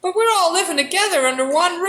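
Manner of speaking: high, hesitant mouse voice; timid mouse. Acted. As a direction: stammer like a shy little mouse. Mouse voice in skit.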